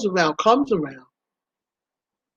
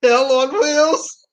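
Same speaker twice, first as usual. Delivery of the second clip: slow, high, on the edge of laughing; drawn-out, tickled disbelief; stifled laughter; 7 semitones higher, much slower than usual. Real, not acted. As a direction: squeeze it out slowly, holding back laughter. Breaking up over her bad choice.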